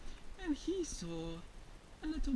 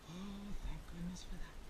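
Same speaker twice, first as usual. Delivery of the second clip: very soft, near-whispered sigh of thanks; hushed relief; 5 semitones lower, 10 dB quieter than usual. Acted. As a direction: breathe out relief quietly. Bear relieved after safe landing.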